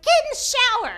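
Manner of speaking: high, loud frantic order; panicked mom. Retold or imitated. Mom panicking over phone at bleeding daughter, shrill loud order. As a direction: bark it like a terrified mother. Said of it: "she's like".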